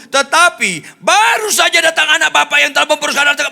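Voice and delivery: high shouted accusation, speeding up; rising voice. Acted. Elder son accusing his brother, shouting. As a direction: raise the voice into an angry tirade. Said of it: "naik suaranya".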